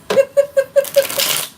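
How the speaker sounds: a sudden, very high squeal of laughter; high burst of laughter. Real, not acted. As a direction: break up laughing, high and loud.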